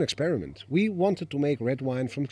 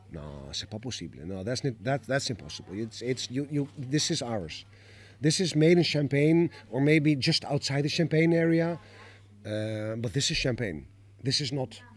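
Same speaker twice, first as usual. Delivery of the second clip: put-on French accent. French locals refusing to believe.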